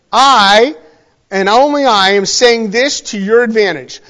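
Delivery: "I" set apart and hit loud; emphatic stress on I. Retold or imitated. Preacher voicing Jesus to his listeners, very emphatic on "I". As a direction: punch "I" hard, then pause. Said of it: "Jesus is saying very emphatically".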